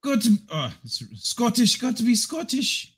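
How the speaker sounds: put-on high, halting practice Scottish accent; Scottish accent attempt. Streamer practising for the corrected impression, tentative Scottish accent. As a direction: feel your way into a Scottish accent. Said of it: "Okay, Scottish".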